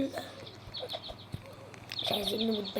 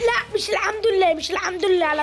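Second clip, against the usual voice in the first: high, loud wail of objection; real loud protest; 8 semitones higher, 6 dB louder than usual. Real, not acted. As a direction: cry out against the bad luck. Protests a bad turn in game. (اعتراض بزعيق وصوت عالي)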